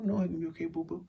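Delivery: normal talking voice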